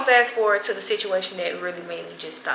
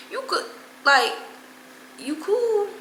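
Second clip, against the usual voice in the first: mock concern; 8 semitones higher than usual. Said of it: "Let me stop playing".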